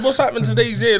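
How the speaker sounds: loud, fed-up question to a teacher; retold complaint. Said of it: "I said".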